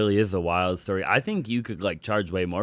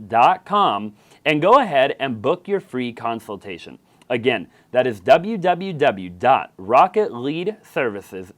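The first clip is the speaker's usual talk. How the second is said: louder, punchy sponsor read, spelling out the web address slowly; 5 semitones higher, 9 dB louder, slower than usual. Acted. Podcast host reading a sponsor ad to listeners, upbeat ad voice. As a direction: sell the free consultation like a radio spot.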